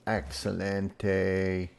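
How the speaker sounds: slow, drawn-out Spanish "Excelente"; mock Spanish accent. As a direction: savour it like a proud Spanish chef. Spanish word drawn out slowly.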